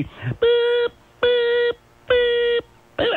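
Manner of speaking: high piercing electronic beeps; alarm clock beeps. Shown as a demonstration. DJ mimics an alarm to wake listeners, high beeping. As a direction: beep like a shrill alarm clock. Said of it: "I'm gonna be your evil alarm clock".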